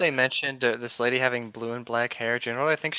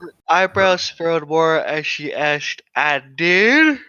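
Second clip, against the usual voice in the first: slow, louder theatrical read; dramatic reading; 3 semitones higher, 10 dB louder, slower than usual. Acted. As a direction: ham up the reading. Dramatic reading of story lines.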